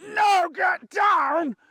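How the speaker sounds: high drawn-out shout; big slow roar at the dog; stern shouted command. Shown as a demonstration. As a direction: bellow a long, harsh telling-off. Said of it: "most of you don't go".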